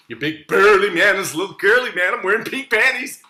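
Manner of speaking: high, loud melodramatic confession; dramatic wail. Acted. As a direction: wail it like a tragedy. Anguished confession.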